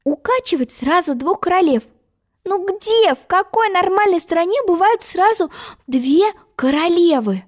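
put-on high-pitched girl, puzzled and put out; musing Alice. Alice rocking two queens at once, high exasperated musing. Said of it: "рассуждала Алиса".

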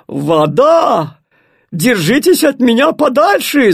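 loud shout with Вода falling. Acted. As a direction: yell it, warding her off. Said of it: "закричал ей огонь". (огонь кричит на воду: громкий крик)

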